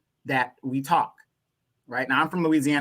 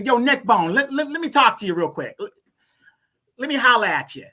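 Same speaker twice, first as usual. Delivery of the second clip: street talk; 6 semitones higher, 5 dB louder than usual. Shown as a demonstration. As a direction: call out to him like a guy on the block. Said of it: "No, I'm gonna say".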